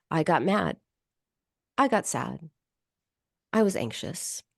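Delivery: indifferent statement. Shown as a demonstration. Therapist demonstrating emotion named with indifference. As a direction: report each feeling like a dull fact. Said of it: "stating a fact with indifference almost".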